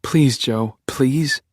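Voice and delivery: high, desperate begging. Acted. Beck begging Joe to let her go, high and desperate. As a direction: beg him, rising on the second please. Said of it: "But you beg".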